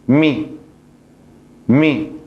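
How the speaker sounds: short clear mi with kasra; Arabic syllable mi. Shown as a demonstration. Teacher modelling kasra sound for learners, clear mi. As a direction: say mi twice, crisp. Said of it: "con la kasra se pronuncia".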